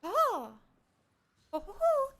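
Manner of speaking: high, excited thanks; gushing up high; squeaky delighted thank you. Real, not acted. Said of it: "delighted reaction".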